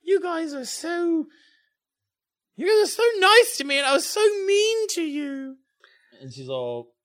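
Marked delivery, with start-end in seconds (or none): 0.0-5.6 put-on high-pitched, emotional girl character; upset schoolgirl. Schoolgirl apologising to the friends she was mean to, high and upset.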